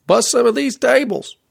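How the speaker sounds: high-pitched bellowed order; waitress bellowing. A narrator's reading. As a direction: holler it high, like a waitress across a kitchen.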